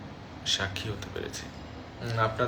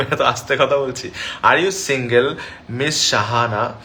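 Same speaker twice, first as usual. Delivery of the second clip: louder reply; 8 dB louder, slower than usual. Real, not acted. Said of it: "এত আস্তে কেমনে কথা বলে?".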